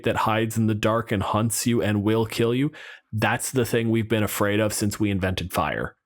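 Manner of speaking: ordinary delivery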